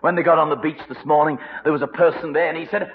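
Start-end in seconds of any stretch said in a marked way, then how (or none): none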